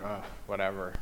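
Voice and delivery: low, soft, bored brush-off; uninterested sigh of a reply; dismissive. Acted. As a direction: shrug it off under your breath. Acted bored reaction to Deuteronomy.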